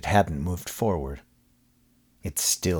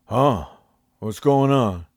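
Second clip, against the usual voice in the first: homeless man voice; 5 semitones higher, 5 dB louder, slower than usual. A narrator's reading.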